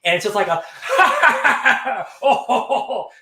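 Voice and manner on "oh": loud, high mock guffaw; hearty put-on dwarven laughing; imitated dwarf laughter. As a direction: boom out a big laugh like the dwarves. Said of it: "of the dwarves laughing".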